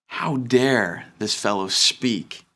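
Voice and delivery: ordinary delivery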